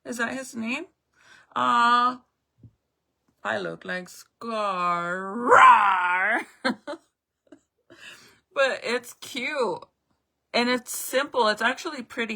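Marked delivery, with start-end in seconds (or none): 5.4-7.0 loud burst of laughter. Real, not acted. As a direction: crack up loud. Laughs at looking like Scar.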